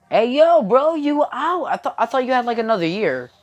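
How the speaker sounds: loud, high-pitched disbelief, easing off; surprised greeting. Acted. Sensei greeting Izuku back early from jail, surprised. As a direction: blurt it out excitedly at a returning friend. Acts out surprise at friend's return.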